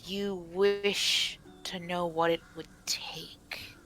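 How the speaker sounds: slow whispery spirit voice; ominous forest spirit. Acted. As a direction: draw the words out in a slow whisper.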